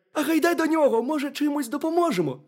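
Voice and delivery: high, squeaky little hedgehog; very high, loud cartoon critter voice. A narrator's reading. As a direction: pipe it up as a tiny curious hedgehog. Hedgehog suggests helping the hare.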